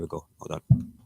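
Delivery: a hint of Boris, murmured softly; Boris Johnson impression. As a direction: lightly mimic Boris Johnson on a phone call. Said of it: "my best Boris Johnson impression".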